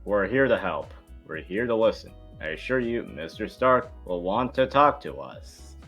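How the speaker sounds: ordinary talk, nothing marked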